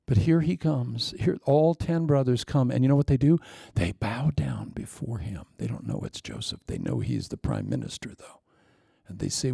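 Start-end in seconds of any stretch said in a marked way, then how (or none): 3.7-8.4 hushed whisper. Acted. Preacher tells the congregation the brothers bow, hushed whisper. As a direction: drop to a hush for the moment. Dramatic hush at brothers bowing.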